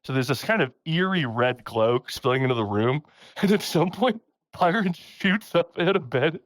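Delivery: ordinary talk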